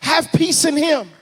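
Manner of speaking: high, loud, drawn-out proclamation; preaching at full force. Acted. Preacher voicing God's promise to the church, full-force preaching. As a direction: swell from a slow opening into ringing exhortation.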